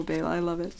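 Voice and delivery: normal talking voice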